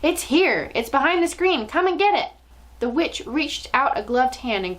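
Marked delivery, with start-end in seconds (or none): 0.0-2.3 triumphant yell. A narrator's reading. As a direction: yell it out like you've caught your prey. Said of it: "yelled triumphantly".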